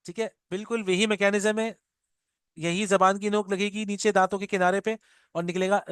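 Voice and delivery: normal talking voice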